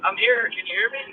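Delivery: caller sounding distant and muffled; talking through a speakerphone. Real, not acted. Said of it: "Sounds like you're on speakerphone".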